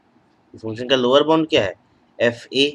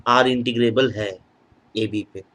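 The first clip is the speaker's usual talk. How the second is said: ordinary delivery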